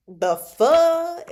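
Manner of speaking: loud, high, baffled outburst; appalled disbelief. Real, not acted. Reader reacting to a lustful line, appalled. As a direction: blurt it out in disgust.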